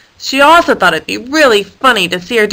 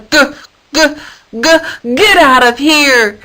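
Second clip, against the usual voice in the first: high, louder, slow bleating g-g-get; stammering goat bleat; 5 semitones higher, 3 dB louder, slower than usual. A narrator's reading.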